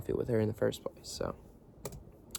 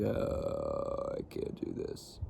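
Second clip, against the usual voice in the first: weary Bob impression; much slower than usual. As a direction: sigh it out like a tired dad overwhelmed. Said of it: "he'd be like".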